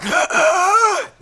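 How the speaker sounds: sharp high inhaled gasp; cat sucking breath. Retold or imitated. Cat on a sleeper's chest, a sharp gasp in. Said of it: "the fucking cat's just fucking sitting there going".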